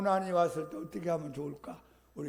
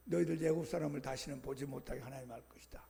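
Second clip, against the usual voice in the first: whispered promise; 5 semitones lower, 8 dB quieter, faster than usual. Acted. Moses reassures the complaining people.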